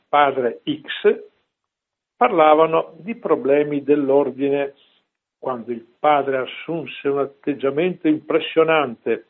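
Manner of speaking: ordinary delivery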